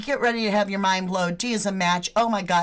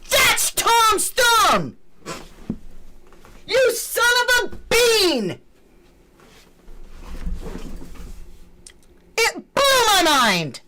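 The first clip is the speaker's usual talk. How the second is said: excited shouting; 11 semitones higher, slower than usual. Real, not acted. Vlogger to viewers on the thumb reveal, disbelieving yells.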